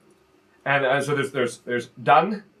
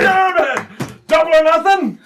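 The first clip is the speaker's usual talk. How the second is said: high, loud frustrated shout; sore loser; 13 semitones higher, 5 dB louder, slower than usual. Acted. GM voicing the beaten wrestler demanding double or nothing. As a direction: demand a rematch.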